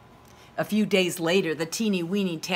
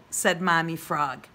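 ordinary delivery, nothing marked

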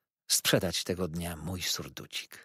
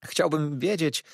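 put-on timid boy, higher and slow; trembling; 6 semitones higher than usual. A narrator's reading. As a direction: ask it slowly, afraid of the answer. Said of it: "drżąc cały".

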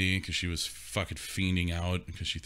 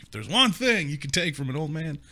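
put-on old man lecturing; 8 semitones higher, 3 dB louder than usual. Old man's advice voiced.

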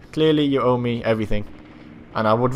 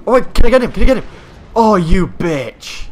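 high, loud and urgent; frantic excitement; 7 semitones higher, 8 dB louder than usual. Real, not acted. Player trying to get him before he escapes, frantic. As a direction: raise it high and loud, chasing the kill.

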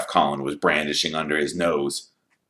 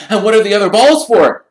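put-on high, loud, eager boy's voice; excited young fan; 13 dB louder, faster than usual. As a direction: gush at your hero like a starstruck kid. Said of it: "Colin asked".

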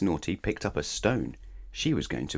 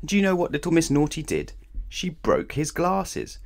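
playful build-up; 9 semitones higher than usual. A narrator's reading. Teasing question to listener.

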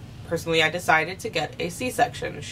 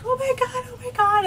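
panicked cry. Retold or imitated. Mother in surgery panicking to the anesthesiologist, high frantic cry. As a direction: squeal it out in alarm as the pressure hits.

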